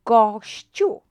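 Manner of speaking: "Gościu" said loud and firm; emphatic model word. Shown as a demonstration. Teacher announcing the answer to the class, loud. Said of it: "what will happen with the C accent".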